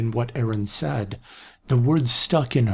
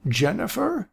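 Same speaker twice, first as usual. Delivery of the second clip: raspy voice; 10 semitones higher than usual. A narrator's reading. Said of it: "came the raspy voice".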